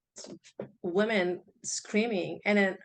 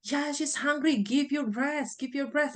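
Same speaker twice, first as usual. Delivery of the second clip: higher, insistent mother's urging; mom urging; 6 semitones higher than usual. Retold or imitated. Grandmother urging the new mother to breastfeed, higher and insistent. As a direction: push her to feed the baby.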